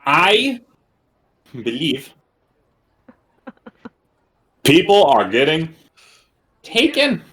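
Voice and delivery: halting words, each in a different put-on voice; many different voices. Bird character explaining wagons and prisoners, switching voices word by word. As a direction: piece it together from borrowed voices, with long pauses. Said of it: "trying so many different voices in ten seconds".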